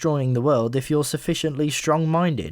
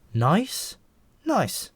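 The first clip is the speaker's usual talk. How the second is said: higher, flustered disbelief; blushing; 9 semitones higher, slower than usual. A narrator's reading. Malcolm, embarrassed by the compliment, asks in high surprise. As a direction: repeat it, caught off guard and pleased. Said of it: "said Malcolm, blushing".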